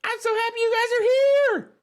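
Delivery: put-on very high, loud squealing kid voice; high-pitched, boisterous child character; excited, boisterous Braylon. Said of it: "have to do this voice".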